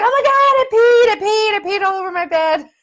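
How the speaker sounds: shrieking in alarm that she peed; high-pitched panicked cry. Retold or imitated. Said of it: "And I'm like".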